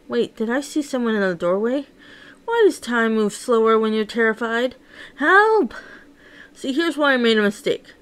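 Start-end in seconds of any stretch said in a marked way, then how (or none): 5.2-5.7 high, frightened shout; cry for help. Acted. Manic cornered by a killer, calling high for help. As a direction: scream out in terror.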